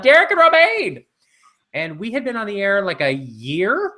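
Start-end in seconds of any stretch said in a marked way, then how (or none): 0.0-1.0 high, loud call across the street; excited fan yelling names; yelled greeting. Retold or imitated. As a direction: shout their names from a car. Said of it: "yelled out".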